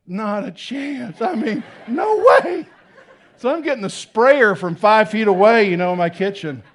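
laughing no way; laughing refusal. Real, not acted. Refusing to hold vomiting toddler.

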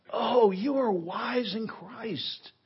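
mock admiration, pitched high; sarcastic rebuke. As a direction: heap on false praise with a sting. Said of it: "Very sarcastic, very stinging rebuke by Paul".

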